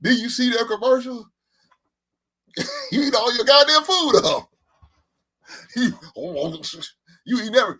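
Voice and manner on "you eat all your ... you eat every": parent's scolding voice, broken by laughter; high and laughing, play the parent scolding; laughing mock parent. Imitating a parent who scolds the kids at dinner, laughing.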